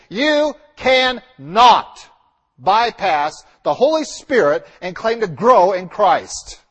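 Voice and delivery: "you cannot" dragged out slow and loud; emphatic. Real, not acted. Preacher warning the congregation, slow and loud. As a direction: hammer "you cannot", then press on. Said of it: "let me say this again emphatically".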